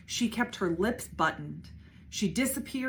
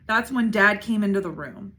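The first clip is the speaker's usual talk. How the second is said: ordinary delivery, nothing marked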